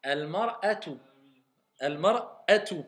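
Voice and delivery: Al-mar'atu, with a catch before atu; glottal stop clear. Shown as a demonstration. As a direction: mark the hamza in Al-mar'atu. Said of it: "c'est la hamza".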